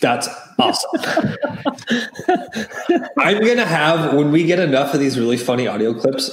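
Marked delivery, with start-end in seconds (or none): 0.9-3.1 burst of laughter. Real, not acted. Laughing at radio bit.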